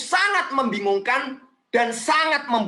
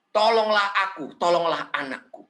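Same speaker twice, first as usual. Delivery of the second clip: worshipful pleading; 4 semitones lower than usual. Acted. Mother begging Jesus for her child, subdued pleading. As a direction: let the plea drop away humbly. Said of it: "mendekat lalu dia menyembah".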